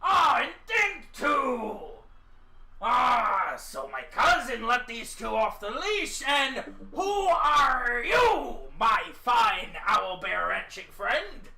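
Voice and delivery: loud, slow, hearty rancher; excited rancher. Acted. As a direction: bellow a slow, cheery greeting. DM rancher voice continues.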